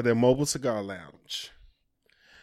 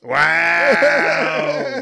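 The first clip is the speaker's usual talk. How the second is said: louder, shouted wow; mock shout; 6 dB louder than usual. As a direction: blurt it out in disbelief. Mock outrage continues.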